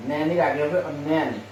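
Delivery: ordinary talk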